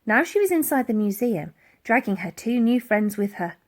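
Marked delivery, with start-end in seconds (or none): none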